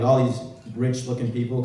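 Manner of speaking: ordinary talk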